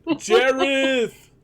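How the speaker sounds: high-pitched, drawn-out call. Acted. Player's character summons Jareth from afar, calling out. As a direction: sing out the name to summon someone.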